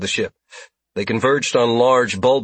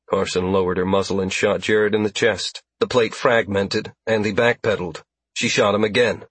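usual speaking voice

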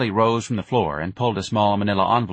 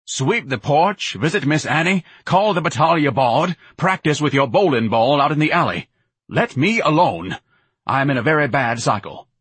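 high, emphatic dismissive commands; fed-up, imperious listing; exasperated ordering; 7 semitones higher, 5 dB louder than usual. Acted. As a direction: order your mother off, worked up. Exasperated son orders mother away.

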